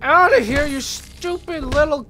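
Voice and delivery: hammed-up angry outburst. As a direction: build from irritation to yelling at a pest. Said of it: "I'm angry. I'm freaking angry".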